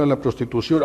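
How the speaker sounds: ordinary talk, nothing marked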